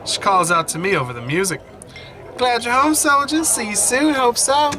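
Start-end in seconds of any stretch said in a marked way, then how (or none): 2.4-4.7 high, lively barmaid calling out; pub woman. Acted. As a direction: sing out a cheery goodbye as the pub lady. Pub woman calls out farewell.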